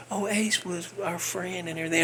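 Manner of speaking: mock sentimental. Mocking the band's new grief.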